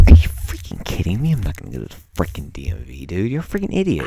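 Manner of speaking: acted-out incredulous scolding; mock indignant rant. Talking himself out of going to the DMV, mock indignant. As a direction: rant at yourself in disbelief.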